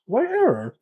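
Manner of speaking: What error? pitched high with a falling end; exasperated disbelief at the machine; baffled. Real, not acted. Host baffled by the soldering station's error message.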